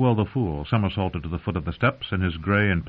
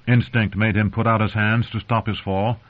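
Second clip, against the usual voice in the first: ordinary delivery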